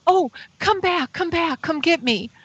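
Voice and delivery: high-pitched, urgent coaxing plea; pleading. Retold or imitated. Woman calling the stopped craft back, pleading.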